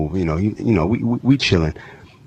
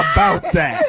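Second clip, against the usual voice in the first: laughing. Real, not acted. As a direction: burst out laughing. Group bursts laughing.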